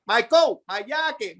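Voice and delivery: "yake" for jacket, with a Y sound at the start. Retold or imitated. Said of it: "the Y's and the J's are backwards".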